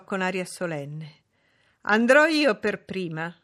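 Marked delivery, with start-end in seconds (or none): 1.9-3.3 loud announcement like a troop commander; commanding. A narrator's reading. Lady announces she goes first, commanding. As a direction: declare it as if leading into battle. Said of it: "col tono del comandante".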